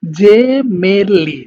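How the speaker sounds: জেমেলি drawn out very slowly, first vowel "e"; correct Italian pronunciation. Shown as a demonstration. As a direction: stretch জেমেলি syllable by syllable, "je-me-li". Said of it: "এটা হবে এ সাউন্ডের দিকে যাবে".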